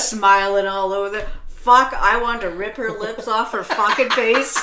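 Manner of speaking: loud, heated venting; exasperated anger. Real, not acted. Woman venting to a friend about the smug shopper, furious. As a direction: let the outrage burst out loudly.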